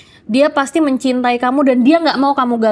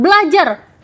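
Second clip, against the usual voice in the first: mom's order to study; 6 semitones higher, 4 dB louder, slower than usual. Retold or imitated. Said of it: "mama bilang".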